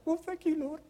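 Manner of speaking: squeaky delighted thank you; mock high thanks. Preacher mimicking his own squeaky relief at the verse. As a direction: chirp it up high with glee. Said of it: "I'm like".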